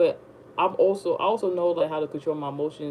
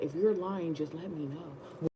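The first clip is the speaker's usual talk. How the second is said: much softer, slow coaxing; coaxing softly; 10 dB quieter than usual. Retold or imitated. Woman pressing her to confess, soft slow coaxing. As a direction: lure her in gently.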